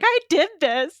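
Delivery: crowing with pride, high and loud; triumphant, laughing little brag; proud boast. Retold or imitated. Fern owner showing off her fern's baby, proudly. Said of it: "so proud of that baby".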